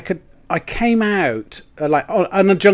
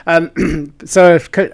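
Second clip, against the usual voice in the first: ordinary talk, nothing marked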